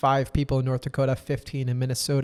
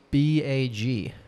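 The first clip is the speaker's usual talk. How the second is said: slow spelling; much slower than usual. Shown as a demonstration. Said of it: "I'm gonna spell it first".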